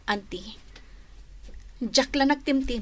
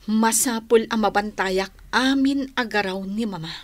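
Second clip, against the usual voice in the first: ordinary talk, nothing marked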